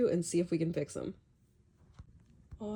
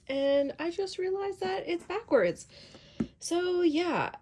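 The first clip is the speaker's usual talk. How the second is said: dismayed realization; 10 semitones higher, 3 dB louder than usual. Real, not acted. Realizes project sewn backwards.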